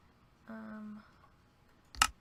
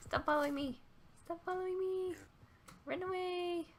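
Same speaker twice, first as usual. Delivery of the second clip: higher, louder mock panic; playful alarm; 10 semitones higher, 3 dB louder than usual. Real, not acted. Telling the following pigs to go away, playful mock panic. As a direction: shoo them off in a fake fright. Pigs chase her in game.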